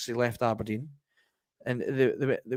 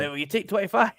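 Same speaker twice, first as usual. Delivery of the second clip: cheeky haggler, pitched up; mock lowball buyer; 6 semitones higher, 3 dB louder than usual. Buyer insulting a seller with a lowball offer, mock cheeky voice. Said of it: "you're not gonna go".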